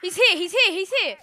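high loud urgent alarm; panicking gamer. Real, not acted. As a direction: sound the alarm, frantic. Enemy spotted mid-game.

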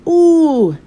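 high loud yelp; Mister Nosy hit on the nose, yelping; yelped ooh. A narrator's reading. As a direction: yelp as the ball hits your nose. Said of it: "yelped Mister Nosy".

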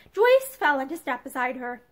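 usual speaking voice

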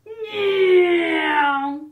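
loud high shouted zoom noise; a child playing aeroplane, full blast. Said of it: "went Baby Bear".

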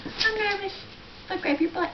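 a light high dog voice; voicing the nervous dog. Owner speaking for the dog, lightly high. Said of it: "She said".

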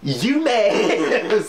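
high-pitched mocking jeer; teasing sing-out on the edge of laughter; taunting fanboy. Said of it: "Xbox fanboys are gonna be like".

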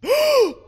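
sharp, high startled gasp; exaggerated shocked intake of breath; loud gasp. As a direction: gasp hard, dramatically. Gasp at the game's kiss.

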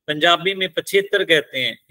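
ordinary talk

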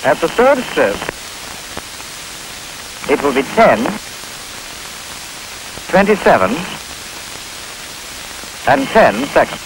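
choppy recorded clock voice; pieced-together speaking clock; spliced together words. Real, not acted. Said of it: "The complete announcement is broken into sections".

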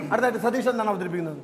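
normal talking voice

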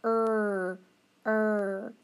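a held er; teacher models the er sound. Shown as a demonstration. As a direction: model er twice. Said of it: "Then er".

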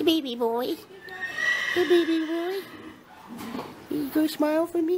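high, sing-song pet voice. Real, not acted. Owner cooing at her horse to smile, high baby talk.

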